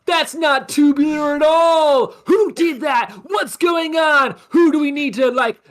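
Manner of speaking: high, loud outraged surfer shout; an indignant surf kid yelling; surfer dude shouting. Acted. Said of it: "A muted trumpet plays".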